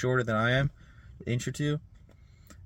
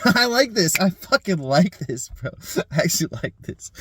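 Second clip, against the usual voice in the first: real giggly excitement, pitched up; laughing delight; 7 semitones higher, 4 dB louder than usual. Real, not acted. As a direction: crack up, thrilled with your own idea. Delighted at his own idea, laughing.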